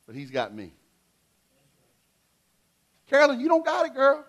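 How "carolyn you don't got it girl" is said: high-pitched teasing call; playful call-out. Real, not acted. Pastor teasing Carolyn from the pulpit, high and playful. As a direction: call her out across the room, high. Calling out to congregant.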